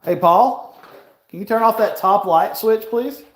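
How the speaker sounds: shouting a polite request to a friend; calling out. Real, not acted. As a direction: call out to Paul for the light. Calling to Paul in another room.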